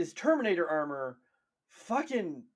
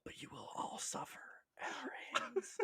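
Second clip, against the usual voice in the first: menacing whisper; 14 semitones higher, 10 dB quieter than usual. Acted. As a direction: whisper doom to a whole world. Imagined whispered fear-tactics broadcast.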